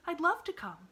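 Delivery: put-on a girl eagerly accepting. Girl accepts the invitation, high and eager.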